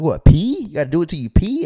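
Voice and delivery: loud, high incredulous question; baffled disbelief. Retold or imitated. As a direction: act out a clueless kid's shock. Naive kid baffled in retold talk.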